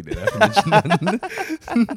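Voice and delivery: laughing. Real, not acted. Laughs at the article's remark.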